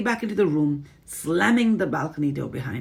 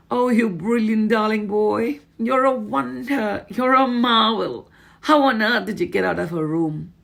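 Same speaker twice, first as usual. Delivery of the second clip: delighted grandmother; 7 semitones higher, 7 dB louder than usual. Acted. Grandmother praising boy.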